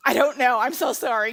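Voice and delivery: loud hammed-up apology; mock helpless outburst. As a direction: burst out as if overwhelmed by choosing. Acting out failing to pick one.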